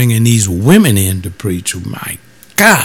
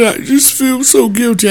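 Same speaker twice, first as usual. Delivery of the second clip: high, plaintive imitation; mild mock guilt; 11 semitones higher than usual. Pastor imitating the man who felt guilty, high and plaintive. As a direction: mimic the guilty man's whine, pitched up. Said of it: "and he said".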